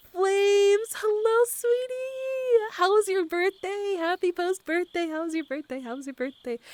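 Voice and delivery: very high, cutesy hello easing downward; gushing greeting. Real, not acted. Greeting a viewer about their birthday, gushing. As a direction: coo at a favourite friend.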